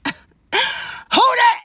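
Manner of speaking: high, loud mock-outraged yell; outraged outburst. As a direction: blurt it out as a hammed-up jealous shriek. Outburst at seeing another girl's notification.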